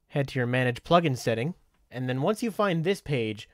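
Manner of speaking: usual speaking voice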